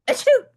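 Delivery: high, loud hammed-up achoo; big theatrical sneeze. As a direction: blast out a cartoon sneeze. Gerald sneezes again.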